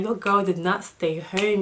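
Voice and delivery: ordinary talk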